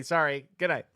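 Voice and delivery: ordinary talk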